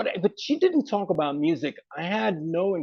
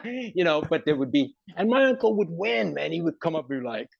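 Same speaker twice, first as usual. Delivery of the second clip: usual speaking voice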